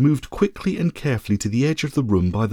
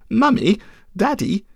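put-on high, nasal child's whine; nasally whiny boy; 9 semitones higher than usual. A narrator's reading. Ugly child calling his parents, high nasal whine. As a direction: whine it through the nose like a spoilt little boy. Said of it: "in a rather nasally and whiny voice".

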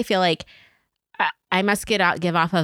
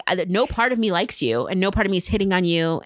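ordinary talk

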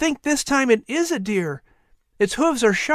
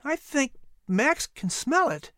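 put-on high, softer, slow nervous boy; 12 semitones higher, 5 dB quieter than usual. A narrator's reading. Boy fears the nearby panther, softly nervous. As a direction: hold back, a touch of worry. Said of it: "Patrick said nervously".

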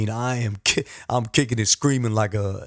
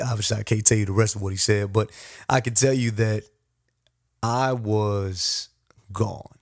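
ordinary delivery

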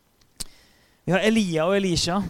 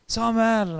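higher and louder call of the name; God calling the sleeping Samuel, loud; 7 semitones higher, 6 dB louder than usual. Retold or imitated. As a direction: call across the dark to wake him. God calls the sleeping boy.

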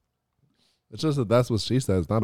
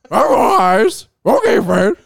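high, strained cartoon dog voice; Scooby-Doo voice, half laughing; Scooby-Doo impression; 5 dB louder than usual. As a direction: play the goofy cartoon dog. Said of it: "do your Scooby-Doo impression".

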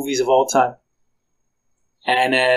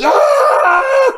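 long, loud, very high yell; imitated wild scream; 5 dB louder than usual. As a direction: scream like the exhilarated pilot in mid-flight. Imitating the pilot's thrilled scream.

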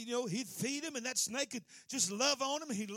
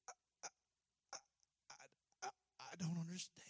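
dying man's stammer; 7 semitones lower, 12 dB quieter, much faster than usual. Acted.

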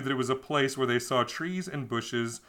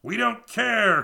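slow, loud and high defiant cry; cried out; 9 semitones higher, 6 dB louder, slower than usual. A narrator's reading. Sailors defying Columbus, a slow loud cry. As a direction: shout it slowly in the sailors' defiance. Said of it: "cried the men".

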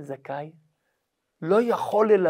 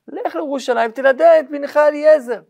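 insistent urging; 7 semitones higher, 8 dB louder than usual. Acted. Sons urge their father.

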